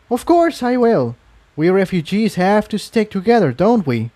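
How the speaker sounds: a friendly lift, happy to help a fellow refugee; Incendia's voice. A narrator's reading. Narrator lightly voicing Incendia agreeing to help Iron Mane.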